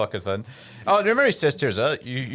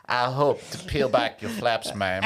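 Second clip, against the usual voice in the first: mock southern gentleman; slower than usual. Mocking southern suitor lines.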